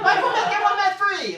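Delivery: high, loud, laughing sales voice; mock ad pitch. Pretend meth ad barking a deal, high and loud. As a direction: ham up a cheesy bargain ad.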